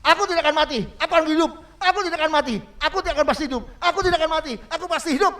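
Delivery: loud, high proclamation of faith; bold declaration. Shown as a demonstration. Preacher showing the sick man what to proclaim, loud and high. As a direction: declare it like a victory shout. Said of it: "katakan begini".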